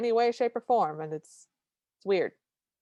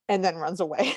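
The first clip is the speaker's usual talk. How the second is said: ordinary talk, nothing marked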